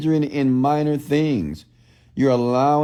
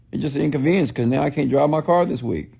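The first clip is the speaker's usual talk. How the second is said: ordinary delivery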